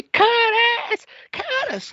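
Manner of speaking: very high shrieked name; mimicking the wife's piercing screams of Curtis; mock screaming. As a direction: shriek it like the annoying wife. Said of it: "every single time she screamed".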